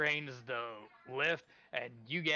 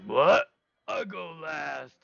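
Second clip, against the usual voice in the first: loud rising yelp, then a slow drawn-out reply; put-upon ogre, high and drawn out; indignant ogre; 4 semitones higher, 11 dB louder, much slower than usual. Acted. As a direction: balk loudly as a simple ogre. Ogre reacts to going last.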